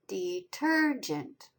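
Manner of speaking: "Detergent" very slowly, weight on the second syllable; careful, slow "Detergent", leaning on ter; stress on TER. Shown as a demonstration. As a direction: draw out "Detergent" as de-TER-gent. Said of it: "It's the second syllable".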